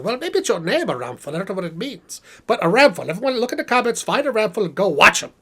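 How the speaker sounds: ordinary talk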